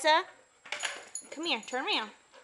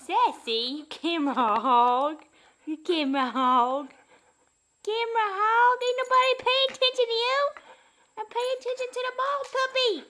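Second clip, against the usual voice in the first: louder, higher mock scolding of the dog; playful teasing; 10 semitones higher, 6 dB louder than usual. Real, not acted. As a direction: tease the dog, getting higher and quicker. Mother teases the dog playfully.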